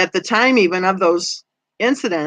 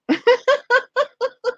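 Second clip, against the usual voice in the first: high laugh. Real, not acted. Reading chat, laughs out.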